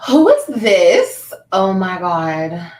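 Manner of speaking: gasp, then loud, slow disbelief; shocked gasp. Real, not acted. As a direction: react in real shock, drawing out the words. Startled by something seen on screen.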